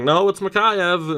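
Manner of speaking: put-on Russian accent. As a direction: imitate a Russian fan correcting Mikheyev in a Russian accent. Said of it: "in Russian accent".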